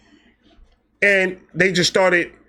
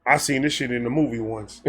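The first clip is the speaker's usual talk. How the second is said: muttered aside; 5 semitones lower, 6 dB quieter than usual. Retold or imitated. Wary aside on entering party.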